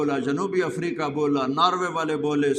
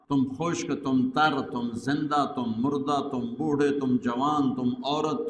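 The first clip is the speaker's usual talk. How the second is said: ordinary delivery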